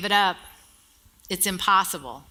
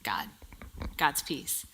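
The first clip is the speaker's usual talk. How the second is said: whispered, much softer; hushed; 8 semitones lower, 14 dB quieter, slower than usual. Real, not acted. Preacher to congregation, whispering the key phrase. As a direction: breathe the words reverently.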